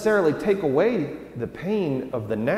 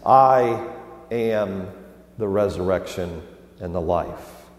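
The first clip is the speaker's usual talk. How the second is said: slow "I ... am", pauses between words, then lower; stress on am; 5 semitones lower than usual. Shown as a demonstration. Pastor stressing the present tense of Jesus' words, slow with pauses. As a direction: set each word apart, weight on "am". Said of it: "Present tense".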